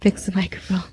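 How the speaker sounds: quick whispered aside. Real, not acted. Guest whispering to host while fixing her mic. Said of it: "I have a low voice".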